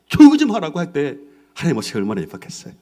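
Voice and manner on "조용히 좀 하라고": Caleb shouting. Acted. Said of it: "무슨 말 하냐고".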